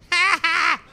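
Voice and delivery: loud, high mock cackle; mocking laugh. Check-in woman laughing as he walks off, loud mocking laugh. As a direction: imitate a gloating two-beat laugh. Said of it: "She was laughing".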